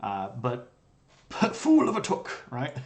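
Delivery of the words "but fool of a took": high mock wizard exclamation; hammed-up Gandalf line, louder and higher; laughing mock wizard cry; Gandalf quote.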